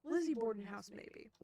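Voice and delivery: ordinary delivery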